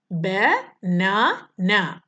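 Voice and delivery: Banana stretched out, each syllable separate; banana said very slowly in three beats. Shown as a demonstration. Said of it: "വേർഡിനെ നമ്മൾ different syllable ആയിട്ട്".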